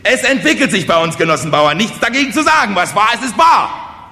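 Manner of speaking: high, loud, strained proclamation; declaiming village orator. Acted. Kosonossow proclaims progress to the peasants, shouting oratory. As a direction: build to a shouted slogan.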